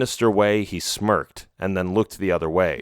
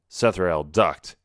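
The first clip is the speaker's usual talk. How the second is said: ordinary delivery, nothing marked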